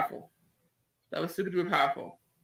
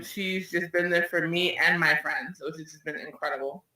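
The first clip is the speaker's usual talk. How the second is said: ordinary talk, nothing marked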